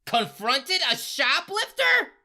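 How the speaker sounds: high, slow, put-on outraged voice; mock outraged teacher. Faculty member scolding Ruby in a high, slow, outraged voice. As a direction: drag out the shock like a scandalised teacher. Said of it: "who are like".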